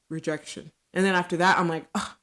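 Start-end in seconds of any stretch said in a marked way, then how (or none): 1.9-2.1 louder, fast, defensive retort; defensive huff. Retold or imitated. Wife answers her husband's rejection with a fast defensive huff. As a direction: snap back, hurt and defensive. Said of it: "now I'm defensive".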